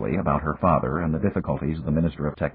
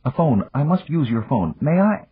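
put-on high, eager young woman; eager little bursts, pitched high; excited request; 9 semitones higher than usual. A narrator's reading. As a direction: ask in an excited, rising pitch. Excited heroine hurries to leave.

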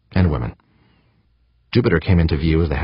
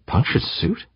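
puzzled, high rising question; wondering; 9 semitones higher, slower than usual. A narrator's reading. Eavesdropping astronaut to himself, wondering. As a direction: question it to yourself, pitch lifted. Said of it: "Gaeta wondered".

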